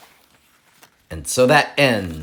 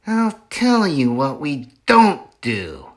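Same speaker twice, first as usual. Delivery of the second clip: character voice, a touch higher; menacing Bean; 4 semitones higher than usual. Acted. Bean answering his partners, scheming. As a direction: say it slyly like a scheming farmer. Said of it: "Bean said".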